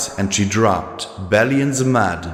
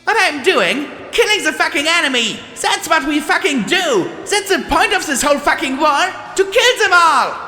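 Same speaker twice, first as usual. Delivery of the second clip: furious yelling; faster than usual. Acted. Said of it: "The mare yelled at Twist".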